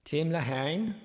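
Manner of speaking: bad Irish brogue on Tim Lahane, rising. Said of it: "put a little brogue on it".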